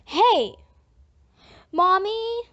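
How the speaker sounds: put-on loud, high child's call for mommy; small child yelling for help, loud and high; child's cry. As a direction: yell for mommy in alarm. Child crying out for mother.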